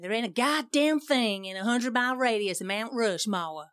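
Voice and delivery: slow, exaggerated Southern US drawl; fake southern American accent. Imitating partner's complaint about Mount Rushmore, fake southern accent. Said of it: "You don't quite say it that southern".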